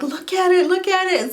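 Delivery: high, loud, urgent repeat; eager, loud retold plea; excited. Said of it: "And I was like".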